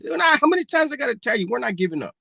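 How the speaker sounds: NOT jumps high, then a fast high rant; exasperated insistence. Retold or imitated. Narrator scolding his friend for wanting to quit, high exasperation. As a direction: snap back high and fast, fed up. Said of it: "looks at me like".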